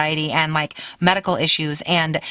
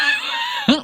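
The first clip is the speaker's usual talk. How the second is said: high burst of laughter; sudden laughing fit. Real, not acted. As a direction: crack up mid-introduction. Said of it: "Why am I laughing?".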